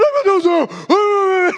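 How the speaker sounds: high, hammed-up wailing; mock despair. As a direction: play the girl in fake despair. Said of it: "ficou desesperado". (desespero fingido, voz bem aguda)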